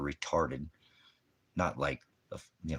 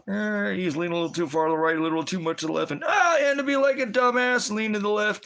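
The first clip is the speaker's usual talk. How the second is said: much higher, louder whiny parody voice; mocking pundit voice; 9 dB louder than usual. Preacher mocking Charlie Kirk's critics, shrill whiny parody. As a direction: sneer out the critics like a nagging caricature. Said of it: "Charlie Kirk".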